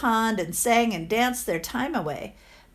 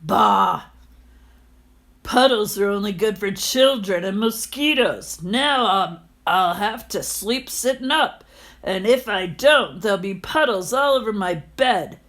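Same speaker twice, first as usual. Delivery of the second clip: put-on a louder sour old miser griping; grumbling landlord; 4 dB louder than usual. Landlord grumbles about puddles in his head, louder griping. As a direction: complain like a grumpy old man.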